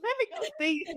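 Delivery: very high, comic put-on voice; mock squeaky voice. Joking about Vicky checking her kidney, in a very high mock voice. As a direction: squeal it like a cartoon.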